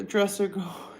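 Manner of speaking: ordinary talk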